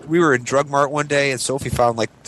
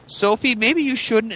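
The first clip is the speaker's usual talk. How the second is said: hesitant warning; 10 semitones higher, slower than usual. Retold or imitated. Warning child about to fall.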